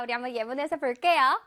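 ordinary talk

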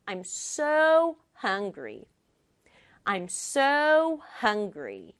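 ordinary talk

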